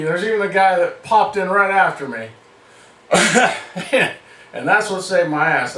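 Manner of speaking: usual speaking voice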